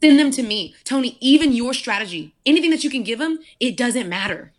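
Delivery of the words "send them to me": louder and higher, hitting send; emphatic repeat. Retold or imitated. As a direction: push it out with conviction. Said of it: "feel the Lord, even in this moment, say".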